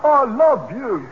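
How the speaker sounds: strained, drawn-out avowal; reluctant declaration. Acted. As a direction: force it out haltingly, with great embarrassment. Pressed to say he loves her.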